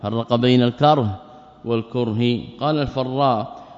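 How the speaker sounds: ordinary talk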